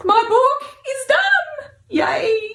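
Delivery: high, joyful shout; excited cheer. Real, not acted. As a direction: cry out in real joy. Celebrating her finished book.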